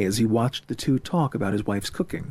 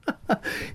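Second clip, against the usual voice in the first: short, light laugh; teasing chuckle; 6 dB quieter than usual. Acted. Friend laughs at Murray's confession, teasing. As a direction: chuckle at a lovesick pal.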